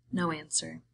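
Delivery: usual speaking voice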